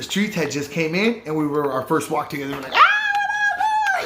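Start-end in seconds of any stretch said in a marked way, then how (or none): 2.7-4.0 very high, drawn-out shout of greeting; excited yell. Retold or imitated. Friend greets his homie from the streets, excited high yell. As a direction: squeal out a wild hello. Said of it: "we were excited".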